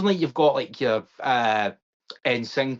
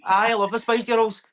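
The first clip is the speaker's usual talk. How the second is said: put-on higher, playful Glaswegian accent; Glasgow accent; 9 semitones higher, 3 dB louder than usual. Co-host plays along with the joke, mock Glasgow accent. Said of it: "like, a Glasgow accent".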